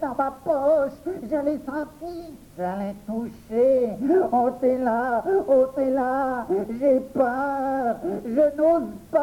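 high, slowed pleading full of fear; terrified. Acted. The boy begs the children to take the frog out. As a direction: whimper and beg, too scared to act.

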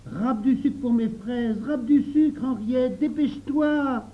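put-on high, slow, emphatic boyish call; high, demanding child; insistent order. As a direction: press her to hurry, stretching each order up high. Jacques orders Henriette to hurry.

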